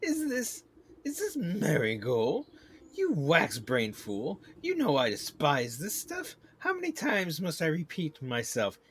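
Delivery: loud, high, theatrical royal outrage; scornful queen bee. Acted. Queen rejects beekeeper's marigold, scornful and angry. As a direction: scold like an offended queen, building to a dismissal.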